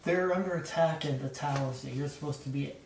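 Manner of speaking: ordinary talk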